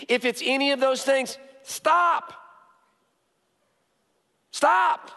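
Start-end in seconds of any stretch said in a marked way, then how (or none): 1.6-5.1 shouted command. Acted. Pastor commanding the congregation to stop, shouted. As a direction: shout it like a warning.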